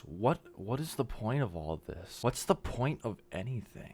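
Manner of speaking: low, soft, pressed through clenched teeth; mild imitation of a drunk friend grinding it out; through gritted teeth. Drunk friend leaning in at the bar, a hint of gritted teeth. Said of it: "with, like, gritted teeth".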